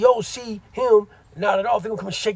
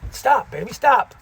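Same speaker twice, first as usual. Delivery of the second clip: higher-pitched command to a dog; real pet scolding; 5 semitones higher, 5 dB louder, slower than usual. Real, not acted. Owner to dog interrupting the story, high-pitched scolding. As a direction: break off and tell the pet to stop, pitched up.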